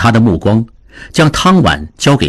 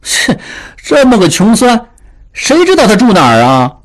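higher-pitched grumbling servant; a bit unwilling; 10 semitones higher than usual. A narrator's reading. Servant grumbling to his master about finding a poor scholar, reluctant. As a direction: complain with a scornful scoff. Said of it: "有点不乐意了".

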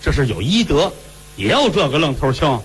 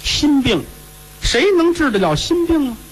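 put-on high, worried old woman's voice; an anxious grandmother at a loss; fretful old lady; 11 semitones higher than usual. Old lady asking who can cure lovesickness, high and worried. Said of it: "老太太说".

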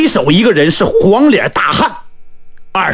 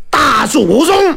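taunt; 7 semitones higher, slower than usual. Acted. Taunting challenge to the bandit.

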